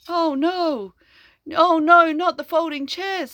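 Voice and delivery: a fussy principal's fake despair; mock dismayed principal. The principal fretting over folding chairs, hammed up. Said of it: "says the principal".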